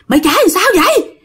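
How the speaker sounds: high, fast furious scolding; fierce cursing. Retold or imitated. Girl cursing the driver who hit her brother, fierce and high. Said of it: "lúc đó bạn hung dữ vô cùng luôn".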